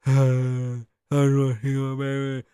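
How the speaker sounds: drawn-out, defeated sigh; mock weary sigh. Mocking [name] sighing to his baby mama. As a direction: drag it out like a broken man.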